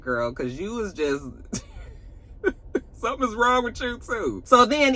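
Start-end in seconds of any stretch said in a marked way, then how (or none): 1.3-2.1 high, breathless giggle; helpless little laugh. Real, not acted. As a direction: crack up mid-sentence. Cracks up at her own remark.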